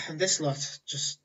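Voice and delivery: bored, sighing complaint; acted teenage boredom; bored young woman. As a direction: grumble through a sigh, unimpressed by everyone. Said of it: "she sat there completely bored".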